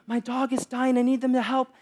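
high-pitched imitation of a panicked woman; mock panic. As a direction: plead for help, lightly hammed-up. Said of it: "she's in a panic".

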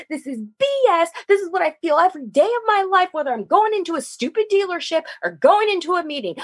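high, rushed, fed-up rant; retold frustrated shouting. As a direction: pour out the anger to dad without pausing. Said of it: "I'm sorry to be shouting at you".

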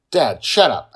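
loud angry shout. Acted. Daughter yelling at her father, loud shout. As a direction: snap it loud at him. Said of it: "Angela shouts at me".

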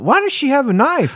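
high-pitched incredulous question; retold bewilderment. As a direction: re-enact baffled alarm with a high voice. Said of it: "I kept saying".

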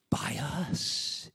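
slow breathy whisper. Acted. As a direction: let it land in a whisper. Preacher whispers the point.

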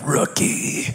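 breathed, unvoiced whisper of one word; self-mocking whisper. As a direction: whisper it to yourself, deflated. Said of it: "I'm like".

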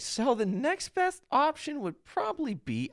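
high and slow, helpful lilt; mock chirpy barista. Barista suggesting another drink, high and slow. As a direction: lift it high like an eager barista. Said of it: "he's like".

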